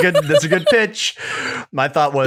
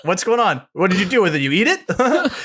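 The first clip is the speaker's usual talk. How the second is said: mock innocent; 8 semitones higher than usual. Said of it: "it was a".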